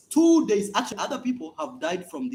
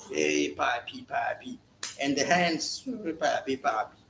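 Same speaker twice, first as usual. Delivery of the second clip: flirty woman; 4 semitones lower, 5 dB quieter, slower than usual. Retold or imitated. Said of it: "a woman gives in".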